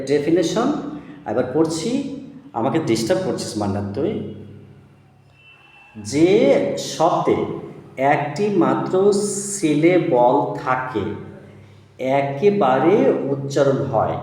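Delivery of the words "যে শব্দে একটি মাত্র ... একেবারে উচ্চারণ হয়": each word spaced for copying; teacher dictating a definition word by word; slow dictation. Shown as a demonstration. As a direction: read the rule out slowly so it sticks. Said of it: "কথাটা ভালো করে মনে রাখতে হবে".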